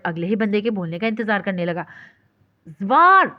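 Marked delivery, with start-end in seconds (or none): 2.7-3.3 much higher and louder, shrieking; shrieking name. A narrator's reading. Caller screams at sleepy friend on phone, shrieking. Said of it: "चिंगारती हुई आवाज़ उभरी".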